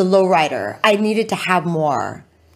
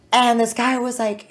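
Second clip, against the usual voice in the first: normal talking voice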